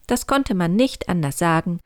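ordinary delivery, nothing marked

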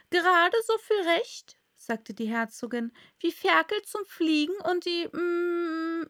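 high, loud teasing duchess. A narrator's reading. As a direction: needle her with a smug comparison. Duchess speaking in story.

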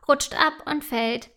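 ordinary delivery

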